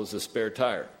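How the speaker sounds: usual speaking voice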